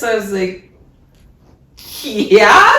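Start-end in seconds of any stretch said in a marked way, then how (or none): 2.0-2.8 high puzzled yeah breaking into a laugh. Retold or imitated. To the hesitant guy, laughing disbelief. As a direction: laugh as if the question is absurd. Surprised at his doubt.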